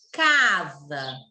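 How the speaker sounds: "Casa" said slowly with a strong CA; careful slow "Casa", weight on CA; slow, stressed demo. Shown as a demonstration. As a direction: draw out the first syllable of "Casa", drop on sa. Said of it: "fala devagar".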